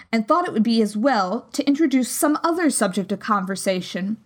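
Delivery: ordinary delivery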